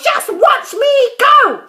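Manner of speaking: high, loud crone's call; calling down loudly. A narrator's reading. Grandma calls down to George from above, loud. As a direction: call down like a gleeful old grandma. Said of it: "she called down to George".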